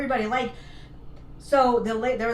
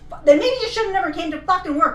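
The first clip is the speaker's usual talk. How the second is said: fast, high, loud comeback; exasperated mock telling-off; retold angry retort; 6 semitones higher, 7 dB louder, faster than usual. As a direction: fire it back hot and fast.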